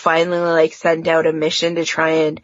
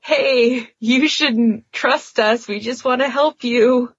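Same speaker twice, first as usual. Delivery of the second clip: higher, slower, over-eager friendly plea; a lightly acted, higher pleading voice; mock earnest reassurance; 6 semitones higher than usual. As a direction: play the rescuers pleading to be trusted. Voicing Earth team to Atlanteans.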